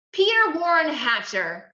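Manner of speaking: high, loud full-name reprimand; stern scolding. A narrator's reading. Mother confronting her older son, stern and loud. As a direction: call him out sternly.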